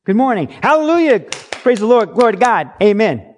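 high, loud churchy greeting; hammed-up hallelujah greeter; Christianese. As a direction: gush holy phrases like an over-eager churchgoer. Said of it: "speak Christianese".

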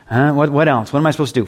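normal talking voice

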